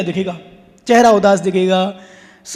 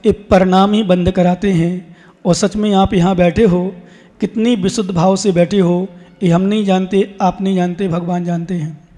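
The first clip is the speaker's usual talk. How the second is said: usual speaking voice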